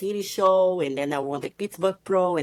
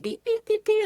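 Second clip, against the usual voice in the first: beeps voiced much higher, like a phone alert; quick high electronic beeps; imitated phone beeps. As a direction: mimic a phone beeping urgently. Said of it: "my phone started beeping".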